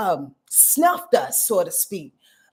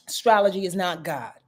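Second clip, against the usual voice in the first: ordinary talk, nothing marked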